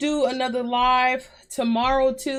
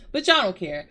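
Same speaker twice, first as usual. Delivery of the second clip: ordinary talk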